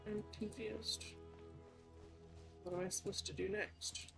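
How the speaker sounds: ordinary delivery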